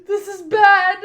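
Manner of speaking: high, very loud, tearful panic; full-voiced distressed yell; panicked wail. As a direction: cry it out in alarm. Panicking after friend vanishes.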